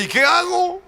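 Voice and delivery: high, pleading question; prompted question. Acted. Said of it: "Y ahora tú dile".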